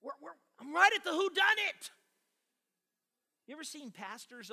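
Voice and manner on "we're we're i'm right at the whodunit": high, loud outburst at an interruption; acted exasperated yell; angry pastor. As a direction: snap loudly at whoever broke in. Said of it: "and they get angry".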